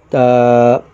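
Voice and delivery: ordinary delivery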